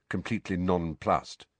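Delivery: usual speaking voice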